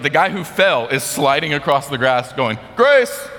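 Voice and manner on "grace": high, loud retold yell; yelled call. Fallen player sliding across the grass, yelling out. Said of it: "they just yelled".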